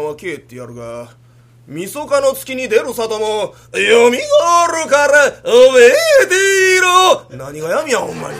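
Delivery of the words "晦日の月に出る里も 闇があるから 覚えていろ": slow, loud, rising stage exit line; kabuki threat. Acted. Son making a kabuki exit threat, slow booming declamation.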